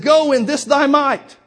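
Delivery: loud, high, slow commanding proclamation; slow booming divine command; booming voice of God. Acted. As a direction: thunder it out slowly as God.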